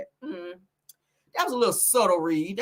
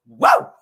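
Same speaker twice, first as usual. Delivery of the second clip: big, loud wrestler's whoop; Ric Flair; 9 dB louder than usual. Retold or imitated. Host mimicking a wrestler's slam, exuberant whoop. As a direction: whoop as if slamming someone down. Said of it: "how Ric Flair used to do".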